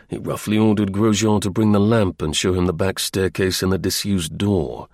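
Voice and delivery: ordinary delivery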